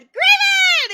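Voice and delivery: loud high call across the room; excited shouted greeting; shouted name. Retold or imitated. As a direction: yell his name out happily. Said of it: "that I shouted".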